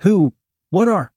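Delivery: startled, rising exclamation; shocked. A narrator's reading. Narrator as startled woman, spurting it out in shock. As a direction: blurt it out in mild shock. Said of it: "I spurted out in shock".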